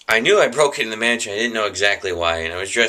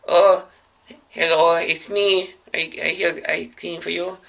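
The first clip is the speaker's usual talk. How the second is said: broken-English cleaner; 8 semitones higher than usual. Acted. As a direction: play a caught intruder faking a foreign cleaner. Dreamer caught, plays foreign cleaner.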